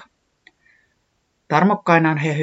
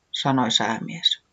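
ordinary delivery, nothing marked

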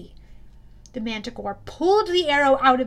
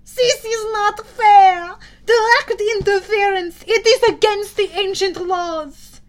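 slow, high, loud wailing cry; the Manticore wailing at unfair interference; monster wailing; 8 semitones higher, 10 dB louder than usual. A narrator's reading. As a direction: howl out the outrage like a wounded beast. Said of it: "The monster wailed".

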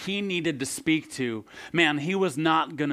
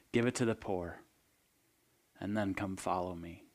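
hushed storytelling; 4 semitones lower, 8 dB quieter than usual. Acted. Pastor tells the rich young ruler's story in a hushed, grave voice. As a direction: draw them in with a near-whisper.

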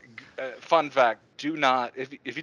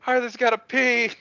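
tattling announcement; 12 semitones higher, 6 dB louder than usual. Retold or imitated. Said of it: "is like".

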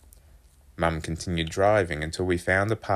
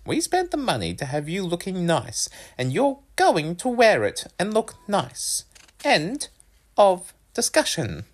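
high, emphatic mother voice; stern, insistent mum; 12 semitones higher, 3 dB louder than usual. A narrator's reading. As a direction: lay down the law as Mum, pitched well up. Narrator plays mum laying down law.

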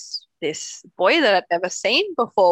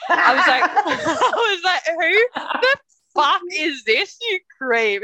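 laughing; 9 semitones higher, 5 dB louder, slower than usual. Retold or imitated. Laughing while retelling the call.

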